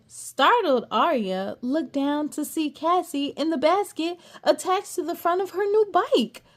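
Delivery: plain reading voice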